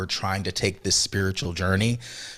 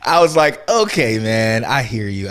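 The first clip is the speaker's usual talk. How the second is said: talking through a laugh, higher and louder at first; 4 semitones higher, 7 dB louder than usual. Real, not acted. Speaker retelling his reply to his inner self, laughing. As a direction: laugh it out, then settle as the words go on. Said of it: "I mean, of course I wasn't laughing".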